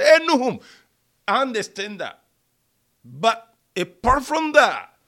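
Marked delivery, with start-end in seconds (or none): 0.0-0.6 teasing call. Retold or imitated. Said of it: "just teasing each other".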